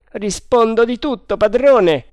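risposta decisa. Acted.

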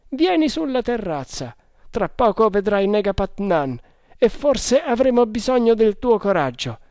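high, loud character voice; hearty sergeant. Acted. Sergeant recruiting the brave hunter, hearty and loud. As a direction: speak up forcefully, pitched above the narration.

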